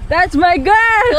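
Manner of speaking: loud slow proud cheer. Real, not acted.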